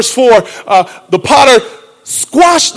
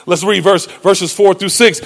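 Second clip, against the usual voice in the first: usual speaking voice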